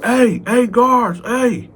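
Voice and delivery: calling the guards. Retold or imitated.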